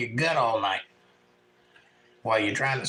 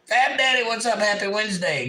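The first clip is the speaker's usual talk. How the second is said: excited greeting; 9 semitones higher, 4 dB louder than usual. Real, not acted. Greeting a viewer.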